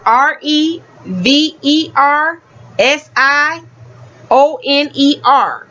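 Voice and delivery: each letter said slowly and separately; spelled out. Shown as a demonstration. Spelling the word out for viewers, letter by letter. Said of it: "the word and position of revisioner".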